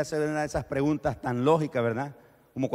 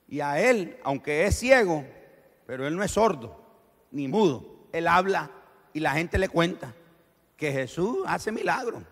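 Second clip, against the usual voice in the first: usual speaking voice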